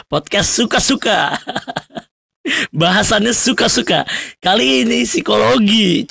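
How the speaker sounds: high, bright mock-boastful cheer; real giddy excitement; playful self-hype. Real, not acted. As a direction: hype up your own show.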